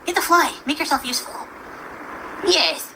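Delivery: high playful pet voice. Real, not acted. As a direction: talk to the dog sweetly. Talking to her dog.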